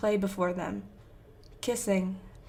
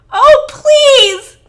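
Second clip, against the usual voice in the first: high, anguished wail at full volume; guttural pleading shriek; 21 dB louder than usual. A narrator's reading. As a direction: tear the plea from your throat. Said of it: "like the words being torn away from his throat".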